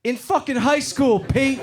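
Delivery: high, loud, slow sarcastic comeback; sarcastic retort. Retold or imitated. Teammate answering his captain, mock sarcastic. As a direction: snap back sarcastically.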